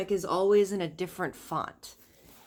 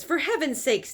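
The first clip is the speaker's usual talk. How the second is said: exasperated; 7 semitones higher, 4 dB louder than usual. Acted. Wife exasperated by the gift.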